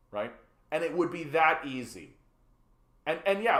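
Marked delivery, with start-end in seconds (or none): none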